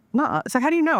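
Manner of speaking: mock-surprised friend asking; disbelief. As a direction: friend asking how she knows, high and incredulous.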